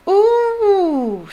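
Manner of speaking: high, loud squeal of delight. A narrator's reading. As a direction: squeal like an excited girl. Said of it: "squealed Lavender Brown".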